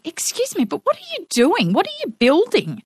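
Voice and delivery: high-pitched imitation of a curious child; young son's voice. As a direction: pipe up like a nosy little boy. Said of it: "my son sticks his head out the window".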